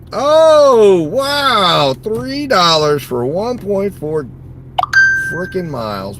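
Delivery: high, loud mock-amazed gasp, then slow; sarcastic shock. Real, not acted. Driver reacting to a three-dollar offer, loud sarcastic mock surprise. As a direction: play it as fake delight at a terrible offer.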